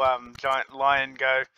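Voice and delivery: ordinary delivery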